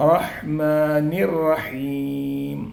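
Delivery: റഹ്മാനിർ റഹീം slowly, heavy റ joined; റഹ്മാനിർ റഹീം drawn out, the ർ linked to റഹീം; joined with tafkhim. Shown as a demonstration. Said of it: "റായിന് ശബ്ദം ഉണ്ടാവും".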